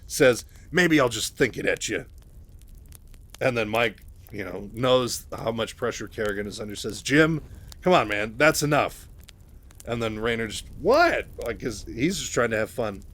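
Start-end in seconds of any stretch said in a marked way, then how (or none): 0.7-2.1 sassy. Acted. Raynor teasing the telepath Kerrigan, sassy. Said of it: "still being a sassy bitch". 7.0-9.1 louder, higher, stopping him; firm rebuke. Acted. Mike telling Jim to stop teasing, firm. 10.8-11.3 high sharp startled what; surprised. Acted. Raynor startled at Mike's rebuke, surprised.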